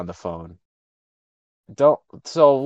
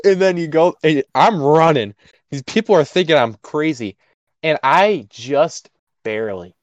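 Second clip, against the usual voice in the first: ordinary talk, nothing marked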